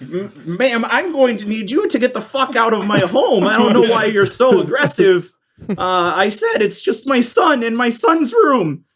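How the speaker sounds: woman scolding the pushy guest; offended hostess. Acted. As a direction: push back, outraged in her own home. Woman angered by shove.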